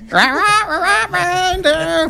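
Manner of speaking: high-pitched silly chipmunk; hammed-up chipmunk impression. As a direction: squeak it way up high like a cartoon chipmunk. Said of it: "your best chipmunk voice".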